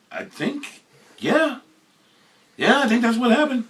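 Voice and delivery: high, rising as it dawns; high, amazed realization; dawning realization. Retold or imitated. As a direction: let it dawn on you.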